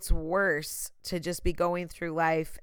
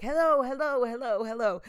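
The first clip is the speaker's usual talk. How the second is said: calling out hello; 6 semitones higher than usual. Retold or imitated. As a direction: shout into the dark, then give up. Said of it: "And I'm, like, screaming".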